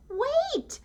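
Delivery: very high, loud cry; Sheep's cry. Acted. Sheep calling the leaving tractor, a very high loud cry. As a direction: cry out after them, high. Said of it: "said Sheep".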